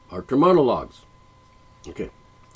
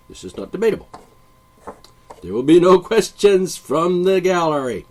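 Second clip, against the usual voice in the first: raised, loud pronouncement; mock authoritarian teacher; 7 semitones higher, 7 dB louder than usual. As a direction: forbid all argument like a classroom ruler. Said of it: "the teacher says".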